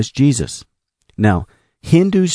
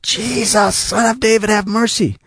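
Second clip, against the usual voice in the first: crying out; 11 semitones higher than usual. Retold or imitated. Blind man calling to Jesus as he walks by, crying out. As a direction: call out high, stretching the words. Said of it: "the blind man began to cry out".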